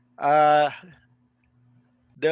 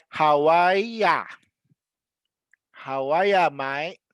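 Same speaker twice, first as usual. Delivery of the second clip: drawn-out Aussie "How are ya", R-less; Australian demo, "are" with no R; Australian, R dropped; 3 dB louder than usual. Shown as a demonstration. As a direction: stretch "are ya" into "ah-ya", Australian style. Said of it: "don't even pronounce the R".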